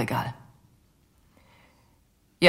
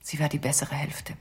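lower, softer, barely whispered; a tender whispered admission; 3 semitones lower, 8 dB quieter than usual. Acted. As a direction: let it fade into a whisper. Confession, emotional trailing.

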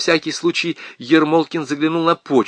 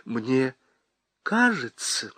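much softer, timid; quiet; 7 dB quieter, slower than usual. A narrator's reading. Wife quietly answers her angry husband. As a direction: say it quietly, not to provoke him. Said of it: "сказала она тихо".

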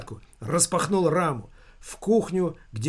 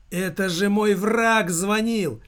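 agitated exclamation; 5 semitones higher, slower than usual. A narrator's reading. Son realises his enemy had phoned.

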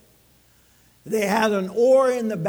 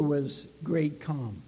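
softer repeat; 8 semitones lower, 6 dB quieter, slower than usual. Acted. Repeated for emphasis.

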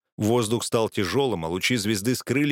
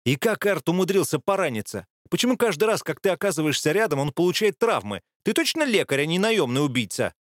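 scolding; 6 semitones higher than usual. Acted. Vilna scolding the healer.

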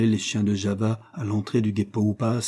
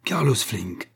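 name flung in rage; slower than usual. A narrator's reading. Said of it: "il jeta son nom avec rage".

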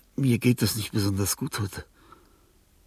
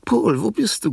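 calling out; 12 semitones higher, 4 dB louder than usual. Acted. Confused old woman calls pet.

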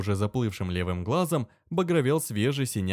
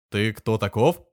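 demanding; 5 dB louder than usual. Acted. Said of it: "требовательно спросил воевода".

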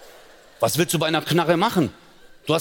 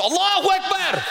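much higher yelled cry; mock battle cry. Comedian scaring the gangster, high mock shout. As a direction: scream it like a wild threat.

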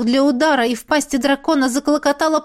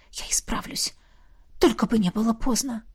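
hushed whisper, much softer; barely voiced, anxious promise to oneself; whispered plea; 7 dB quieter than usual. A narrator's reading. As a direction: whisper it as a private vow.

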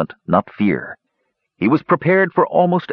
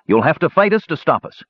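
higher-pitched defiant challenge; fierce shout; 8 semitones higher than usual. A narrator's reading. Tannen goads the unwilling warriors to fight, fiercely. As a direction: throw down the challenge in a mild shout. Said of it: "Tannen shouted fiercely".

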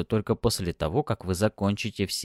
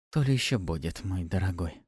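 breathy purring seductress; 2 semitones lower, 3 dB quieter than usual. A narrator's reading. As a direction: tease him with a promise. Succubus seducing, promise.

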